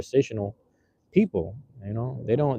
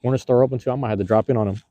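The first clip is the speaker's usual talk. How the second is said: usual speaking voice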